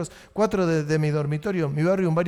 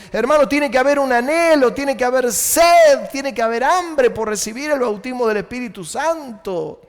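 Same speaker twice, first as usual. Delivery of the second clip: raised, higher fervent preaching cry; preaching with power; 5 semitones higher, 3 dB louder than usual. Shown as a demonstration. Preacher exhorting his congregation, fervent and loud. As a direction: plead with the church for the Spirit. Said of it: "Voy a tratar de hablar con poder".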